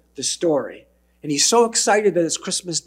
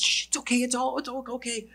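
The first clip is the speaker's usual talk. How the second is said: hushing Scrooge; 8 semitones higher, 5 dB quieter, faster than usual. Acted. Scrooge calming screaming maid.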